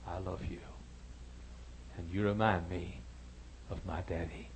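soft unvoiced whisper; a whispered confession of love; whispered tenderness. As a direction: breathe it gently, close to him. Tender reply to the drunk.

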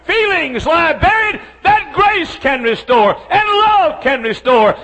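loud, high, driving pulpit voice; full-force preaching. Acted. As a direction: hammer each line home to the congregation.

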